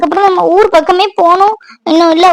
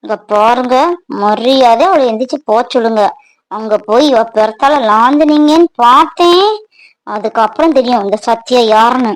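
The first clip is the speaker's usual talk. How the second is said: low jealous warning; 6 semitones lower than usual. Acted.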